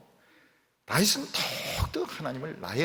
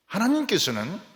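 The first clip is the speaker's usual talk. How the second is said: ordinary talk, nothing marked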